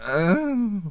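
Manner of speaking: high strangled grunt; mock keeper noise. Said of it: "the keeper just goes / probably didn't make that noise".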